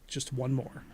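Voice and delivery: ordinary delivery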